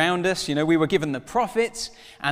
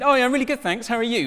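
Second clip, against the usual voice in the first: lightly voiced David Tennant; 8 semitones higher, 4 dB louder, faster than usual. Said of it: "he just went".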